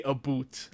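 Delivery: "a boot" said like "aboot" with a long oo; a boot pronunciation. Retold or imitated. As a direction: say "a boot" with a rounded oo sound. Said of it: "I know people that really say".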